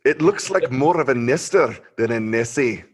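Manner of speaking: big mock-Scottish brogue; Scottish accent. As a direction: read it out in a hammed-up Scottish accent. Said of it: "can you do it in a Scottish accent".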